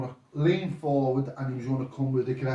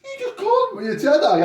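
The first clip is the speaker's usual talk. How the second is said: incredulous laugh; 7 dB louder than usual. Retold or imitated. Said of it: "Kenny goes to me".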